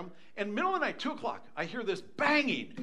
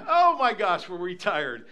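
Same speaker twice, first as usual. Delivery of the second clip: usual speaking voice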